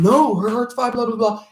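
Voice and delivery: high, loud mock-frantic answer; imitated screaming. Daughter answering the medic's partner, mock screaming. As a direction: mimic a screaming daughter's panicked reply. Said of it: "in the course of her screaming".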